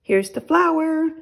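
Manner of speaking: high, loud happy shout; excited shout. Acted. Boy shouting to his mother about the flour. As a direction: call it out proudly to mother. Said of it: "shouted Jack".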